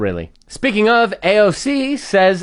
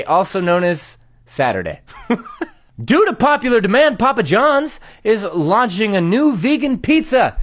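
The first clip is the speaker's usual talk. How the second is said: ordinary delivery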